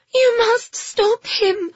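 high, slow, gasping plea; breathless gasp. Acted. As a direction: gasp it out, winded. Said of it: "Phoebe gasped, out of breath".

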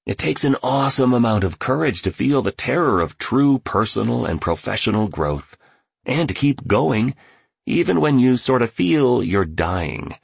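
a hint of an eager motivating titan; lightly voiced billionaire mentor. A narrator's reading.

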